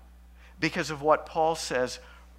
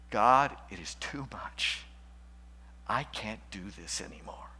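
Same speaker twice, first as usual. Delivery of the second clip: retold whispered plea; 6 semitones lower, 6 dB quieter than usual. Sister on the kitchen floor begging God, whispered. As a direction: breathe it like a woman at the end of her rope. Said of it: "crying and saying".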